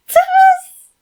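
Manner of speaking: very high, very loud screech; a squealing fanboy screech. A narrator's reading. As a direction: shriek the name like an overexcited fan. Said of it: "Felix screeched in his head".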